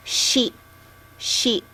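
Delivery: Sheep said with a soft sh, twice; sh sound. Shown as a demonstration. Teacher showing the sh sound with an example word. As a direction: show the sh in Sheep. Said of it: "It's S H sound".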